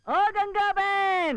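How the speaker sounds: calling out. Acted. Man summons his supposed sister from bathroom, calling out. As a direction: stretch the name out to summon her.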